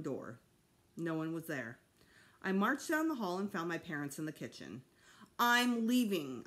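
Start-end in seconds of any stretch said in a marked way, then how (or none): 5.4-6.4 louder, slow dramatic announcement; boy declaring he's running away; announced. A narrator's reading. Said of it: "I announced".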